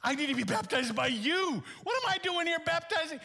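high, fast, shouted protest; John's protest. Acted. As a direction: cry out in unworthy disbelief. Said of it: "John's like".

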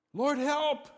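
high, loud cry for help; desperate cry. Retold or imitated. Sailors in a storm cry to God, a high loud shout. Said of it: "And they cried in their trouble".

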